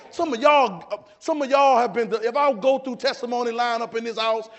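loud, high, forceful sermon delivery; full-force preaching. Real, not acted. Preacher telling congregation they were saved undeserving, full-force preaching. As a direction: build to loud, punched declarations.

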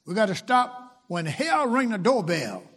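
usual speaking voice

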